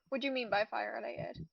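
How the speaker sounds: ordinary talk, nothing marked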